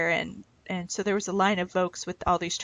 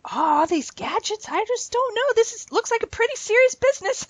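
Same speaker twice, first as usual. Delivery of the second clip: father-in-law's voice; 13 semitones higher than usual. Retold or imitated. Father-in-law eyeing the scrapbook gadgets, bemused old man voice. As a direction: marvel at the gadgets like a puzzled old farmer. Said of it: "How he talks is hilarious".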